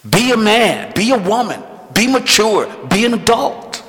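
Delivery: fervent pulpit build; preaching exhortation. Acted. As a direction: rouse the congregation to grow up. Preaching exhortation at full force.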